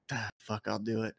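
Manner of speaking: low, quiet, reluctant grumble; grumbling Norman. Retold or imitated. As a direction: mutter it like you really don't want to go. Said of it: "He's like".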